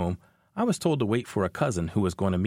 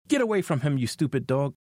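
raised-pitch, mild story yell; narrated yell; 4 semitones higher than usual. A narrator's reading. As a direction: read it as a restrained yell at a dog. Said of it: "I yelled".

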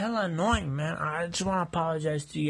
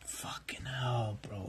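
slow exasperated whisper; 6 semitones lower, 9 dB quieter, much slower than usual. Acted. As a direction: sigh it out under your breath. Exasperated under his breath.

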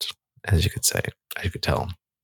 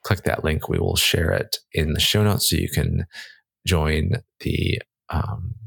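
ordinary talk, nothing marked

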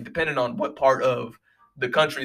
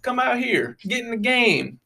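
higher, coaxing party voice; mock peer-pressure crowd; 10 semitones higher than usual. Townsfolk coaxing Scrooge to spend, mock coaxing. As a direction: egg him on to spend. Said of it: "They was like".

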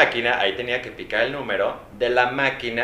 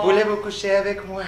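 put-on French accent; 9 semitones higher than usual.

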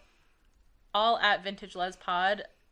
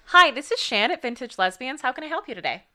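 higher, louder, bright and polite phone greeting; customer service voice; 6 semitones higher, 9 dB louder than usual. Shown as a demonstration. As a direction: answer the phone like a friendly receptionist. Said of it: "What does your customer service voice sound like?".

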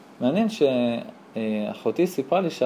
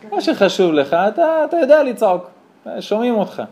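mock scolding; 7 semitones higher, 5 dB louder than usual. Rebbetzin scolds husband, retold.

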